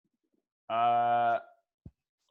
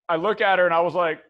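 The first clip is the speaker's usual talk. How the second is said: ordinary talk, nothing marked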